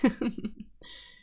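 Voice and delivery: brief airy breathy giggle; light laugh. Acted. Witch laughing at calling him her husband, airy giggle.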